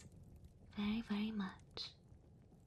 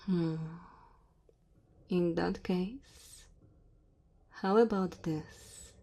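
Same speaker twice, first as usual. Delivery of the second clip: lightly voiced dragon woman, louder, measured delivery; 10 dB louder than usual. Dragon woman to her cold guest in the cave, gently apologetic. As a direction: play an apologetic dragon host at speaking volume.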